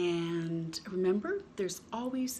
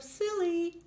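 high silly; 10 semitones higher than usual. Acted. Giraffe looks silly.

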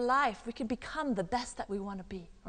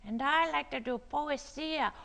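lightly voiced little ladybug puppet; 3 semitones higher than usual. Ladybug puppet tells the children her hobby, lightly voiced puppet. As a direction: speak up as the puppet character. Puppet Lalita speaks about herself.

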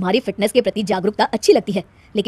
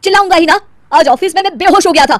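angry retort; 7 semitones higher, 5 dB louder than usual. Acted. Angry husband answers wife.

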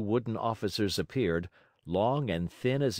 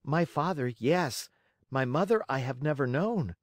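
high little puppet voice; high-pitched childlike reply; Pinocchio answering; 5 semitones higher than usual. A narrator's reading. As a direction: answer as a small, earnest puppet.

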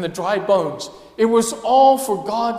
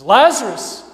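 rising, louder call of Lazarus; loud command; 4 semitones higher, 5 dB louder than usual. Acted. Jesus calls Lazarus from the tomb, loud command. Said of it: "with a loud voice".

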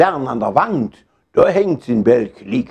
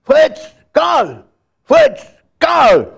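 put-on high, loud shout for two boys; full-force call; 13 semitones higher, 4 dB louder, slower than usual. A narrator's reading. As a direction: holler each name as hard as you can. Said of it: "mit aller Kraft".

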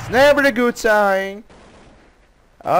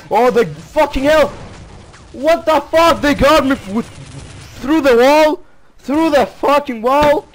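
raging gamer; 6 semitones higher than usual. Real, not acted. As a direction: rant in disbelief at the screen. Killed through a wall.

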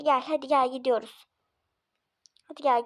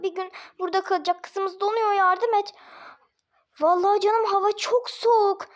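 usual speaking voice

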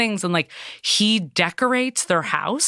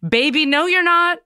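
high, louder imitation of an exasperated wife; mock exasperation; 11 semitones higher, 5 dB louder than usual. Wife corrects her husband in a fight, mock exasperated. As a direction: contradict him in a raised, pitched-up wife voice.

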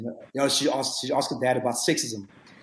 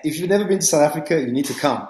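a quick, bright rush of enthusiasm, pitched up and louder; excited invitation; 4 semitones higher, 9 dB louder, faster than usual. Real, not acted. Guest urging listeners to visit South Africa, excited. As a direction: sell it like the best trip of their life.

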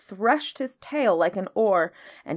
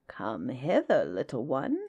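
low, soft crocodile voice; 5 semitones lower, 4 dB quieter than usual. A narrator's reading. Crocodile beckons the elephant's child, low and soft. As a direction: lure the little one closer, low and quiet. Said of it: "said the crocodile".

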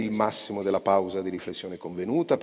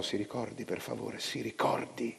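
much softer, urgent reminder; hushed plea; 7 dB quieter than usual. A narrator's reading. Narrator quietly urging his friend to remember, hushed plea. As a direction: lean in and plead quietly.

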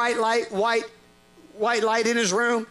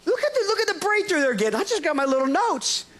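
mock panic; 6 semitones higher than usual.

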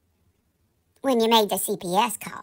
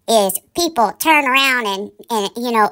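usual speaking voice

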